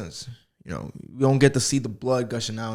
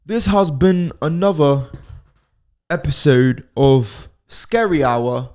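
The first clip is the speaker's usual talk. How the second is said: louder, slow, announcer outro; podcast outro; 3 semitones higher, 5 dB louder, slower than usual. Acted. Closing the episode, a slow announcer outro. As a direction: sign off the show like a host. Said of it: "we're gonna do the proper outro".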